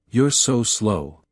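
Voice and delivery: low male voice, slow and scornful; muttering man. A narrator's reading. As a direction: grumble it with contempt, low. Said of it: "Ian muttered".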